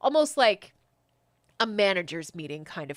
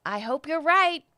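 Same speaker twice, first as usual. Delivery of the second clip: higher and louder, uneasy hope; the second, higher voice; 8 semitones higher, 7 dB louder than usual. Acted. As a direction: press it out, still worried. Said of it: "second voice again go".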